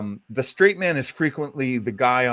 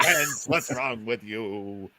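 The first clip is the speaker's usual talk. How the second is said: Abbott impression; 2 semitones lower, 5 dB quieter, slower than usual. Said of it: "Bud Abbott studying his lines".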